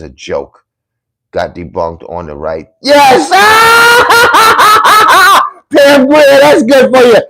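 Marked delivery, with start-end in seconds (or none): 2.8-3.3 high, loud burst of joy; excited yell. Real, not acted. Cheering at a viewer's comment, a loud, high yell. Thrilled reaction on stream. 3.3-5.5 high, loud whooping laugh; loud shrieking laugh. Real, not acted. Cracking up at a comment, loud high laughter. 5.7-7.2 high and loud through laughter; laughing excitedly. Real, not acted. Celebrating a viewer's comment, loud and laughing.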